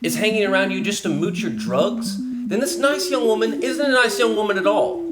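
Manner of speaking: heated warning. Acted. Lecturing warning, rising heat.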